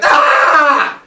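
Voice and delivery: high loud yelp of pain; pained yell. Acted. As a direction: yelp like you just got stabbed. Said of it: "And Father Sky's like".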